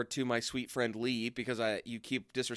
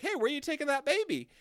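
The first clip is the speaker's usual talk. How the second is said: much higher, fast flustered call; higher fast mock alarm; high panicky Gaius; 13 semitones higher, faster than usual. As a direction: fret after him in a high rush. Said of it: "Gaius follows and is like".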